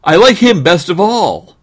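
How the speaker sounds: put-on high, loud boy voice; eager kid announcing a favorite; boy crying out. Boy choosing his puppy, high excited cry. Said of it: "cried the boy".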